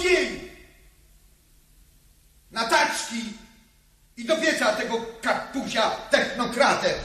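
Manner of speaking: a hint of a fiery comrade rousing the girls; lightly voiced activist comrade.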